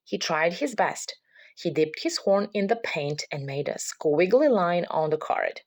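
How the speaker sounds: ordinary delivery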